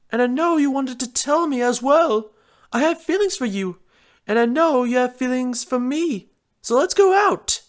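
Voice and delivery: Rarity voice. Acted. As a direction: confess up high.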